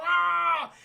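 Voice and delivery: high, loud "aah" scream; mock falling scream. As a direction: imitate screaming down a slide. Acted scream going down a slide.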